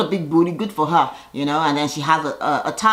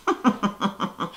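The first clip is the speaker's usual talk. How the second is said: soft laugh; 3 semitones higher, 6 dB quieter than usual. Real, not acted. Laughing at own joke.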